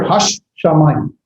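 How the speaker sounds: "Hash Shamayim" slowed, sh closing "Hash" and opening "Shamayim"; doubled shin. Shown as a demonstration. Teacher asking students to listen, slow doubled sh in "Hash Shamayim". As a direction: slowly sound both sh's in "Hash Shamayim". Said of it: "you gotta listen to here".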